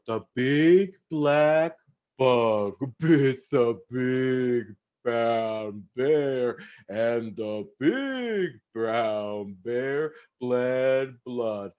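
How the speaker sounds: very slow and drawn out. Shown as a demonstration. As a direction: stretch every word slowly.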